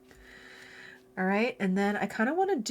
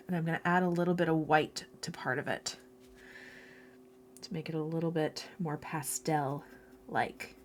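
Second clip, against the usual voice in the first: ordinary delivery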